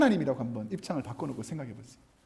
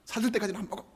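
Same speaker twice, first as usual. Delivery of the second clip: imitating a stubborn little boy; determined childish refusal; 3 semitones higher, 9 dB quieter, faster than usual. Son refusing mother's food until she buys it, mock defiant. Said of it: "탁 결연한 표정으로".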